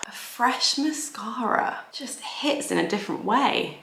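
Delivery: whispered aside. Acted. Confiding to viewers in a whisper. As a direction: breathe it like a guilty pleasure. Aside about new mascara.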